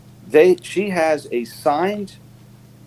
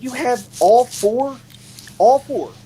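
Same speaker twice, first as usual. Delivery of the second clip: incredulous repeat; 11 semitones higher, slower than usual. Retold or imitated. Stepmother reveals signed Beatles album.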